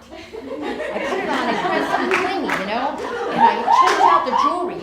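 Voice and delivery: laughing excitedly. Real, not acted. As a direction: giggle your way through the outfit list.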